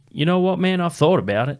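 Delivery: put-on American Southern accent, pitched well up. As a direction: play the country singer musing in an American Southern accent. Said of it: "had an American Southern accent".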